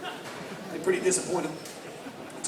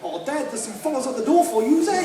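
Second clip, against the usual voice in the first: put-on higher-pitched kid in broad Kiwi; mock a boy's New Zealand accent, pitched up; 6 semitones higher than usual. Boy calls his dad to the door, high Kiwi accent. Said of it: "hear a New Zealand accent on the big screen".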